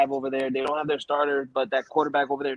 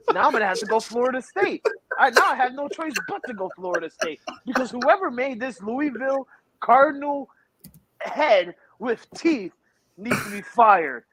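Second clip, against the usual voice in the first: higher, worked-up rant with slowed punch words; hammed-up indignation over a cartoon bird; stretching out "head with teeth" in playful fury; mock outrage; 6 semitones higher than usual.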